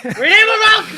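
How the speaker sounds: laughing shout. Real, not acted. Yelling for milk, laughing.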